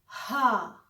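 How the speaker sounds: हा with breathy h; German H. Shown as a demonstration. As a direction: say हा open and breathy. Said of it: "ओ के बाद एच का sound आना चाहिए". (हा साफ़ बोलिए)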